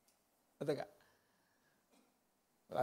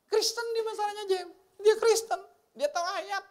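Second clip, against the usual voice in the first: exasperated. Acted. Exasperated about the drunk relative.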